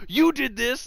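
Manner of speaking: high-pitched retold blame; mock Orton accusation. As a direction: point the finger with your voice, imitating. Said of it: "then just points at him".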